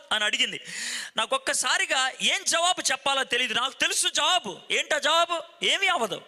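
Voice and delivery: usual speaking voice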